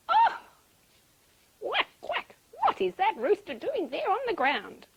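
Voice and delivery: high, loud quacking surprise; startled duck. A narrator's reading. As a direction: squawk in shock like a duck. Duck startled by fallen rooster.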